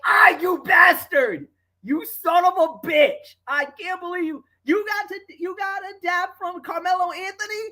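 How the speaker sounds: playful fake rage, high-pitched; mock furious shout. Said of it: "She was so P O'd".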